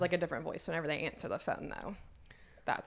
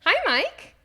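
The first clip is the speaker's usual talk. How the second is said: very high, loud, gushing greeting; imitating an overly cheery phone hello; pleasant phone voice; 11 dB louder than usual. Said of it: "always sound pleasant over the phone".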